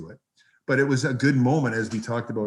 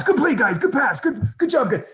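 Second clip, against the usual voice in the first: much higher, louder cheers; excited cheering; 13 semitones higher, 6 dB louder than usual. Retold or imitated. Benched player cheering his teammates from the penalty box, high excited yells. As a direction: yell encouragement through the glass.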